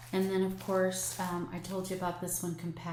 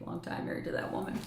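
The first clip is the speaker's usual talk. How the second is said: mock husband; 5 dB quieter, much faster than usual. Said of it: "He probably says the thing".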